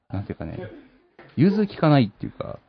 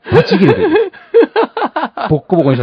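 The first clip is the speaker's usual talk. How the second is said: squealing with laughter, much higher; laughing; 7 dB louder, faster than usual. Real, not acted. Host laughing as he lets the guest rage. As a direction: repeat the rule through a burst of laughter. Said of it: "ブチ切れていいです".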